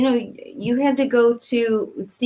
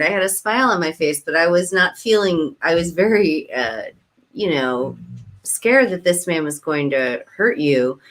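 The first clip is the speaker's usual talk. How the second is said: ordinary talk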